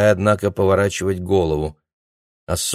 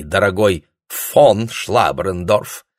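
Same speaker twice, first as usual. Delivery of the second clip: фон stressed mockingly, slow and loud; 4 dB louder, much slower than usual. A narrator's reading. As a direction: lean sneeringly on фон. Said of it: "сделала на фон издевательское ударение". (издевательское ударение на «фон»)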